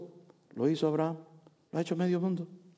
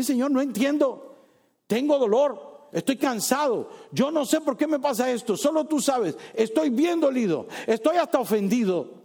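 high, louder heartfelt complaint to God; honest lament; 8 semitones higher, 4 dB louder than usual. Retold or imitated. Preacher voicing an honest prayer of pain, high and pleading. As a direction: pour out the hurt plainly.

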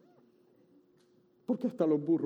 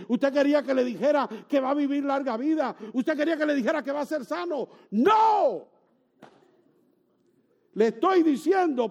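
preaching building to a shout; 9 semitones higher, 6 dB louder than usual. Acted. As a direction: voice climbing higher and louder each phrase. Preaching rises to full force.

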